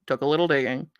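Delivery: low, quiet aside to yourself; low, muted remark. Real, not acted. Repeats remark, dropping back down.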